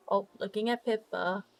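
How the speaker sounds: ordinary talk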